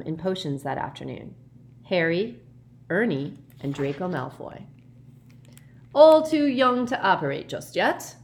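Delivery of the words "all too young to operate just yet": put-on loud, high, jovial old professor; hearty teacher cheer; genial Slughorn. A narrator's reading. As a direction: boom it out kindly to the class. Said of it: "said Slughorn genially".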